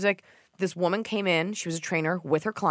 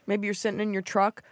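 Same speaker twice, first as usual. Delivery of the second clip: ordinary talk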